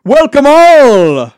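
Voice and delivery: high, loud, very slow booming welcome; grand announcer. Acted. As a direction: boom it like a showman opening the show. Mock grand show opening.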